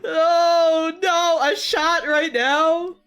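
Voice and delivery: normal talking voice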